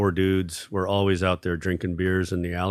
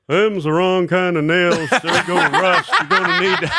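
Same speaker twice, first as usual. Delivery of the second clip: critical neighbor impression; 8 semitones higher, 6 dB louder than usual. Neighbor criticizing the nails from next door, loud high imitation. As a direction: scold loudly in an exaggerated neighbor voice. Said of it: "He's like".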